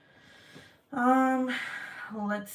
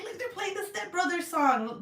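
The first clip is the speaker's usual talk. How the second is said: laughing quote; 10 semitones higher than usual. Retold or imitated. Recalling laughing at fountain.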